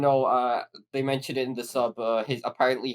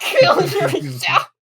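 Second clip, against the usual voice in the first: laughing; 9 dB louder, much slower than usual. Pretend.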